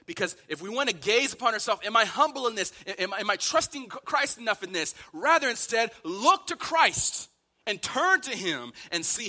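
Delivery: high, loud, driving exhortation; impassioned preaching. Real, not acted. Preacher urging sufferers to look at Christ, preaching impassioned. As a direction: hammer each repeated call, building to a peak. Sermon climax, urging the church.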